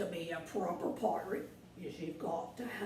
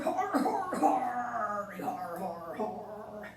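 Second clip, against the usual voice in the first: pirate laugh; 4 dB louder, much slower than usual. Acted. Pirate laughing at his wash-up-ashore joke, hearty mock laugh. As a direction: cackle like a jolly pirate.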